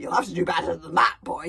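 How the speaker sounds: loud gleeful bellow. A narrator's reading. Gobble taunts Fishlegs from his dragon, bellowing. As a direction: boom it across the practice field. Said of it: "he bellowed".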